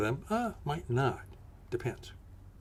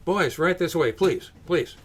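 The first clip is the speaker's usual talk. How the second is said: higher, louder urgent pleas; calling out; 4 semitones higher, 5 dB louder than usual. Acted. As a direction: wave them in, raising the voice. Urging men inside.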